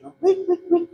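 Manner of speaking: very high squealed pig noise; imitating a squealing pig. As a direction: squeal like a pig being slaughtered.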